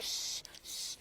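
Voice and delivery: imitated sharp, whispered shushing; mock parental "shh"; hushing a child. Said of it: "we're like".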